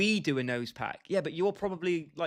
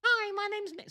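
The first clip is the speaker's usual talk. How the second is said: squeaky, very high mocking voice; Mick. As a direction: tease with a very high-pitched, cracked-voice imitation. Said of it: "I sounded like Mick".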